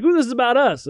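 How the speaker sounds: high, gushing delight; mock excitement. American readers seeing their name, mock gushing excitement. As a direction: gush like a flattered reader. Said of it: "Be like".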